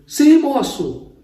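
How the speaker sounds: high, loud, slow reply from a doorway; calling back. Retold or imitated. Church sister answers a stranger at the door, loud high call. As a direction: answer a stranger from inside the house.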